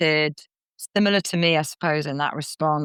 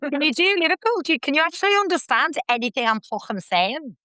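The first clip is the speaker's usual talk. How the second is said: put-on much higher, broad Liverpool accent; 11 semitones higher than usual. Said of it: "So the whole time I had to talk like that".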